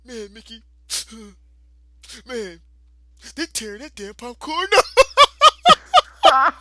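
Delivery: lightly voiced little kid, a touch of a whine; whiny little brother. Teasing his kid brother, a mild whiny imitation. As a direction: mimic a hungry kid brother, mildly whiny. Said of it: "he starts crying".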